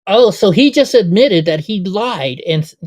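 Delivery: loud, slow disbelief at the clip; a stunned, loud oh-so reaction; incredulous outburst. Real, not acted. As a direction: drag it out loud, as if you can't believe it.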